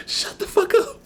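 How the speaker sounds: very high mock-outraged squeak; exasperated retort. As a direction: squeal it in helpless mock protest. Acted retort to the youngster's teasing.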